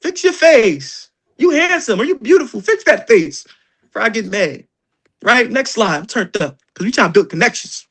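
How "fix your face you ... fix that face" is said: higher, slow and stretched; mock scolding. Trainer teasing the team with a drawn-out mock scold. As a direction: drag it out like a fed-up mom. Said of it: "trainer scolding the team".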